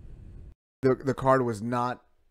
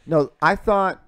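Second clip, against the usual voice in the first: ordinary talk, nothing marked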